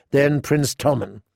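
usual speaking voice

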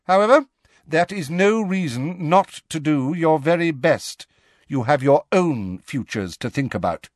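lightly voiced strict professor; firm but kind teacher; stern teacher. McGonagall encourages the class.

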